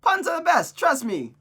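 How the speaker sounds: high, loud and gleeful; excited insistence. Acted. Friend defending the silly show name, excited insistence. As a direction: burst out with total conviction. Character enthusiastically defends the pun.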